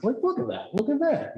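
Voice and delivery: high, eager and insistent; excited pointing voice. Retold or imitated. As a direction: urge them to look, thrilled. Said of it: "It's like".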